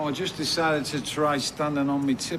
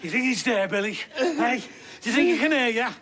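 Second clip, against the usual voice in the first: ordinary delivery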